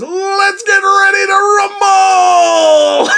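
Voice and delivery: loud, high, long-drawn ring announcer call; Michael Buffer. Retold or imitated. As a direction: stretch it out like a boxing announcer. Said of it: "Michael Buffer is that guy who says".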